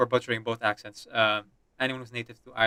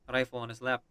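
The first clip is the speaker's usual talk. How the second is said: normal talking voice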